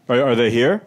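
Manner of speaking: ordinary delivery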